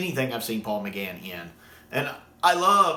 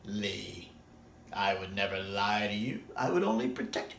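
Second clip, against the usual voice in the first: soft, low, silky reassurance; sly soothing lie; 5 semitones lower, 7 dB quieter than usual. Retold or imitated. The Master lying softly and slyly to Lee. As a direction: purr the lie gently and quietly. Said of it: "that sly smile".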